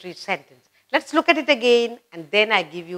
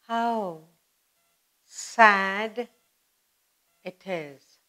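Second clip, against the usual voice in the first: sad, fading away; 4 dB quieter, much slower than usual. Shown as a demonstration. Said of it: "You're feeling very sad".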